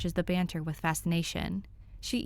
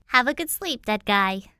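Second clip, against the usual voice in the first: higher and louder, cheery sing-song; chirpy Danny; 5 semitones higher, 7 dB louder than usual. A narrator's reading. Danny bids sleepy Tim farewell, bright and chirpy. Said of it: "Danny chirps".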